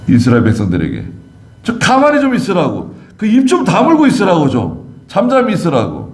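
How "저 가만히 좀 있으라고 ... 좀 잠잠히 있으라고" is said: higher, exasperated order to hush; Moses scolding. Acted. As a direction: tell the crowd to shut up. 모세가 백성을 꾸짖음. (백성에게 입 다물라고 다그치는 모세)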